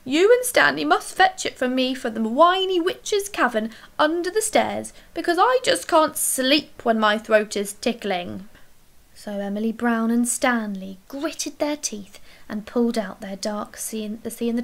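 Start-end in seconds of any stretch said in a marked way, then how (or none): 0.0-8.5 poorly creature. A narrator's reading. The thing whining to Emily Brown about its throat, loud poorly creature. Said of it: "coughed the thing".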